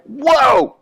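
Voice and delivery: high, hammed-up startled cry; startled whoa. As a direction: jump back in alarm. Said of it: "And he's like".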